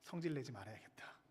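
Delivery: low hushed murmur to oneself; retold inner resolve, nearly whispered; quiet self-resolve. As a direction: whisper a private vow under the breath. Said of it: "저는 이렇게 다짐을 했죠".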